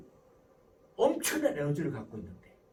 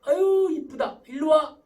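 put-on high, loud gushing over a child; fond, effusive parental greeting; affectionate mother; 4 semitones higher, 9 dB louder, slower than usual. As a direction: act a doting mom calling her son over. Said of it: "하고 불러요".

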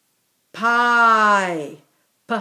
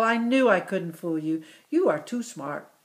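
ordinary talk, nothing marked

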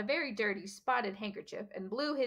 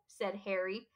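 plain reading voice

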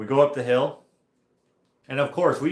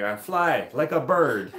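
put-on slow, high, loud French-accented boast; drawn-out mock French accent; 4 semitones higher, 5 dB louder, slower than usual. [name]'s favorite quote from the rider, imitated French accent. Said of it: "I wish I could do the French accent better".

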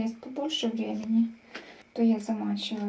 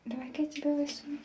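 normal talking voice

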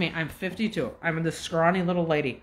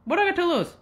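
high, lightly hopeful musing; mock bold shrug; retold inner thought; 9 semitones higher than usual. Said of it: "I was like".

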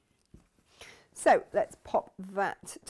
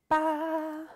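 playful ba; 8 semitones higher, 3 dB louder than usual. Real, not acted. Playful reveal of pincushion.

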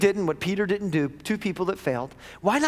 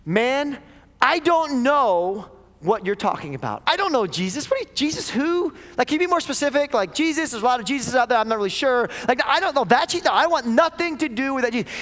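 high, louder, dismissive scoff; Peter's denial; 7 semitones higher, 4 dB louder than usual. Retold or imitated. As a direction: play dumb with mock confusion. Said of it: "And Peter replied".

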